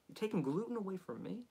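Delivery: hushed but high-pitched mock outrage; indignant body. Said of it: "My body was like".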